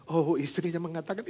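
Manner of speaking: ordinary talk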